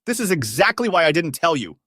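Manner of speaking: high, loud retort; angry scream. A narrator's reading. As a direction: scream back in anger. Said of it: "I screamed".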